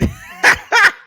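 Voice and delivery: loud, very high burst of laughter. Real, not acted. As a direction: explode into laughter. Laughing at the silly cake.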